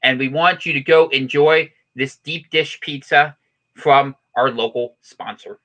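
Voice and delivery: mock news report. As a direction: read it like a hammed-up local newscaster.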